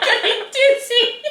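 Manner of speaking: very high, slow, laughed-out words; helpless laughter. Real, not acted. Co-host echoing the dizzy joke, laughing helplessly. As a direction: repeat it while cracking up. Cracks up repeating co-host's joke.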